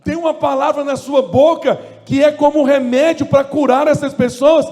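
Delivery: loud, high shouted preaching; full-force preaching. Real, not acted. Preacher exhorting the church, loud high shouting sermon. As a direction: shout the message with fire.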